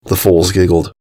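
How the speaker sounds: usual speaking voice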